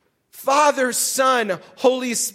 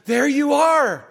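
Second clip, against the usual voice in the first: drawn-out, louder call; delighted recognition; 2 semitones higher, 4 dB louder than usual. Acted. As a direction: spot someone you were waiting for, slowly. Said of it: "he's like".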